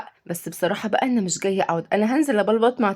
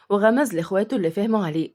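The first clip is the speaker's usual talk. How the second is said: ordinary delivery, nothing marked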